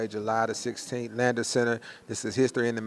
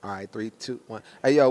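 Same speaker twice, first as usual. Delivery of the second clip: ordinary talk, nothing marked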